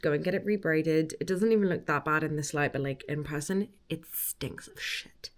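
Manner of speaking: ordinary talk, nothing marked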